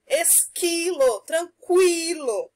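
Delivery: Esquilo with a silent u, tranquilo with kw, both drawn out slowly; slow sound demo. Shown as a demonstration. Teacher to pupils, slowly contrasting Esquilo and tranquilo. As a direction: stretch Esquilo and tranquilo so the kids hear the qu change. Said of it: "porém, mudou o som".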